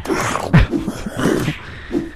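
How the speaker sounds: voiceless, wheezy laughing fit. Real, not acted. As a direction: laugh with hardly any voice. Laughs at horrifying moment.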